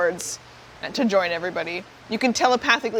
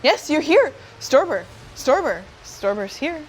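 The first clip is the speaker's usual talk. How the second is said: higher, swooping repeats of the name; odd-patterned hive mind; 5 semitones higher than usual. Acted. Nexus recognizing Storber, odd repetitive lilting voice. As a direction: echo the name in separate, lilting bursts. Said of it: "a pretty funny pattern of talking there".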